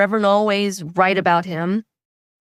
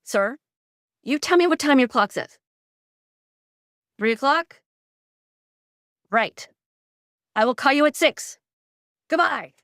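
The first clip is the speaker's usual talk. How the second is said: mock bark; 6 semitones higher than usual. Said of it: "bark at him".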